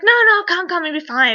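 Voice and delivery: high, loud insistent coaxing, imitated; urgent reassurance. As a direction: wave away the worry and urge them over. Acted urging someone to come along.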